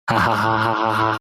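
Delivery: low, soft measured ha-ha laugh; creepy character laugh. Acted. The dog finishing his speech to Nira with a low creepy laugh. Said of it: "God, that fucking laugh".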